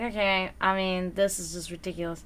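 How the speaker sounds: quiet, fast dismissive eye-roll; a mild mock-unimpressed aside. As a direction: brush it off as ridiculous, under your breath. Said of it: "I just wanna roll my eyes and be like".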